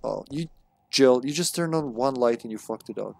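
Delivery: usual speaking voice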